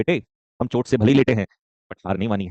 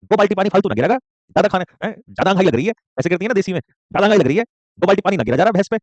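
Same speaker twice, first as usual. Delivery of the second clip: higher, louder nagging mom in country style; scolding desi mother; 5 semitones higher, 3 dB louder than usual. Retold or imitated. Mother orders her child to wash the buffalo, higher and louder scolding. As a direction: scold like a village mother with chores. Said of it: "जैसे हमारी mummy करती हैं".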